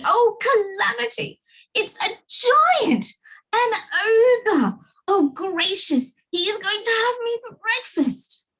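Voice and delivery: high, panicky, crying out in fear; terrified shriek. A narrator's reading. Mister Jelly thinks the tramp is an ogre, high frightened wail. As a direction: wail as if about to be eaten. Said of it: "he screamed".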